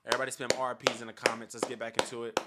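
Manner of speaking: loud hype-up; hyped up. Real, not acted. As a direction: pump the energy back up. Hyping himself back up.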